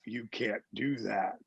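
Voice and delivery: low, hushed whisper; voice of conscience. Retold or imitated. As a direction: whisper it as your better self. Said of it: "my good self says".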